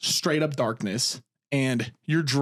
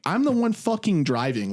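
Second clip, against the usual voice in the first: pitched-up, frustrated insistence; irritated assertion; 7 semitones higher than usual. Retold or imitated. Driver to critical passenger, irritated. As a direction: assert it with a flash of temper. Retold irritation at backseat driving.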